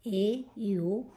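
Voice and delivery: ए, यू said very slowly letter by letter; ए, यू drawn out for the class; spelled-out letters. Shown as a demonstration. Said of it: "ओ का मतलब हुआ".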